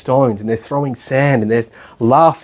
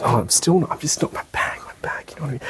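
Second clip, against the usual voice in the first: whispered fussing with the bag; a mild mock whisper; fussy student whisper; 8 dB quieter than usual. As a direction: mimic a fidgety student, barely voiced. Imitating fussy meditation student.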